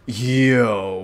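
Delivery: loud, stretched-out exclamation; stunned amazement. Real, not acted. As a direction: draw it out in awe. Stunned by sight in game.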